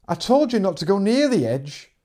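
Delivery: angry shout. Acted. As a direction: bark the warning at him. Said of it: "her shout crackled through the radio".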